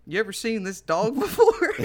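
loud, high mock question breaking into laughter; retold dog owner. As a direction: imitate the stranger while cracking up. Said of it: "And then he's like".

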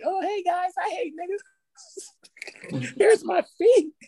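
high, sweet mock girl voice; high girly voice. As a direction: play a cheery girl, way up high. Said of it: "I could just see her".

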